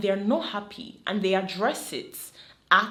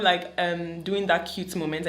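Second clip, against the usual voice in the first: usual speaking voice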